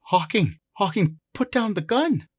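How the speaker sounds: high, loud frightened plea. Acted. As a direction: call out in alarm, pitched well up. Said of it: "and she sounds frightened".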